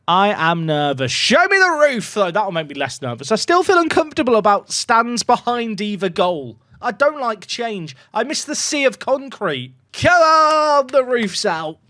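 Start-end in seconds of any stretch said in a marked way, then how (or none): 1.1-2.3 high, loud plea; eager shout. Real, not acted. Demanding the stadium roof. 9.9-11.8 high, loud come on; cheering shout. Real, not acted. Cheering the roof reveal.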